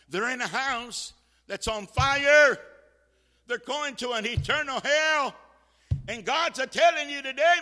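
loud, high, urgent warning; full-force preaching. Real, not acted. Preacher warning of a house on fire and hell, shouted preaching. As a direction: sound the alarm at full volume.

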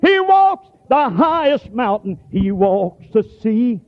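slow, high, drawn-out preaching. Acted. Preacher proclaiming God's power to congregation, slow high preaching. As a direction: stretch each word like a proclamation.

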